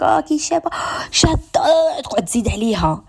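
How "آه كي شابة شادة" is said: mock gushing compliment. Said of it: "تقول لك".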